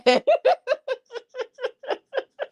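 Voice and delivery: long, high-pitched laugh; high giggle. Real, not acted. Amused by a viewer comment, high laughter. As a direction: cackle out of amusement.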